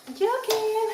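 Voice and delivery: high, loud playful tease. Real, not acted. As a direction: tease them, just kidding. Playful after laugh.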